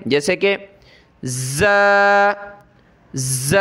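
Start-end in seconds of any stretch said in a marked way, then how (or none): 1.2-3.6 ز buzzed with the teeth pressed together. Shown as a demonstration. Teacher showing pupils how ز is made. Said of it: "دونوں دانتوں کو زور سے ملا کر پڑھا جاتا ہے".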